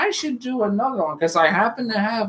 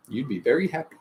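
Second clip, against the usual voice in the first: ordinary delivery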